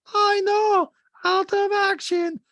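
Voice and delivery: very high, loud, drawn-out impression; Diana. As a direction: mimic a guest in a squealing falsetto. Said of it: "Sutton to Diana goes".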